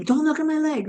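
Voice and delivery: high-pitched mock scream at normal volume; retold scream. Injured swimmer to returning brother-in-law, mild retold scream. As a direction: imitate a scream, high but held back. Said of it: "and I scream".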